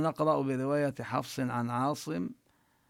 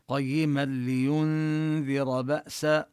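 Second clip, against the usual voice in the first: slow chanted recitation with the nun merged; tajweed demo; 3 dB louder, much slower than usual. Shown as a demonstration. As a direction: recite slowly, no nasal hum. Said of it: "ندغم النون في اللام".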